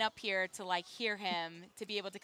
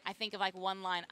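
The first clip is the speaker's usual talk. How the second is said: ordinary delivery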